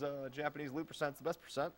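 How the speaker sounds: ordinary delivery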